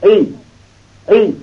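ای sounded out with a high lift; syllable ای repeated. Shown as a demonstration. Teacher modelling ای while reading the line. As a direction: model ای clearly for learners.

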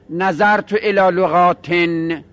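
لغاتن said slowly, ending in "-ten"; slow model phrase, clear "-ten/-tin" closing لغاتن; genitive -in ending. Shown as a demonstration. Teacher showing the genitive case, slow -ten on لغاتن. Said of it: "این باید بگیم".

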